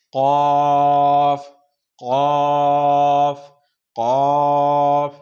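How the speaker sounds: कॉफ very slow with a deep throat q; teacher drilling big qaf. Shown as a demonstration. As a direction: hold कॉफ from the back of the throat. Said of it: "बड़ी कॉफ".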